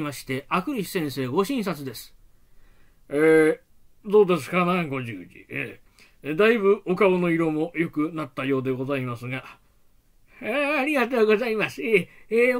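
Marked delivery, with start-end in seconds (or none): 3.1-9.4 low doctor voice. Acted. Doctor's visit.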